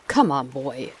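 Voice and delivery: menacing South African drawl; South African accent. A narrator's reading. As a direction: coax the boy in a hint of a South African accent. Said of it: "in his sharp South African accent".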